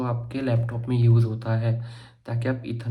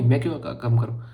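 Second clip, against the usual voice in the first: ordinary delivery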